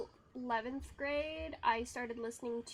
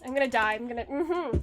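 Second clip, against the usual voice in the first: scared giddy laughing; 8 dB louder than usual. Real, not acted. Game danger, loud.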